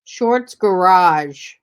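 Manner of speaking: slow, loud and spelled-out; loud, very slow clarification; slow reveal. Shown as a demonstration. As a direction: draw each word out to make it clear. Reveals the misheard name, slowly.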